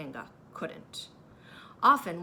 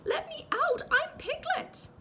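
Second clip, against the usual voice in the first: much higher, loud, slowing pleas; frantic Piglet; 4 dB louder, slower than usual. A narrator's reading. Piglet pleading from the bath, much higher and loud. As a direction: squeal to be let out.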